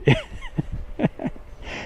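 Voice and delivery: high, breath-only laughing fit; real laugh. Real, not acted. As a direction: laugh helplessly, high and voiceless. Laughing at losing the plot.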